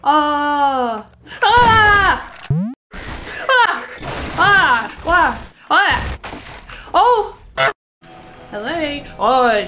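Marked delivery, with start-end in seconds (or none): 0.0-7.4 frightened short screams; scared horror game streamer. Real, not acted. Being chased in game.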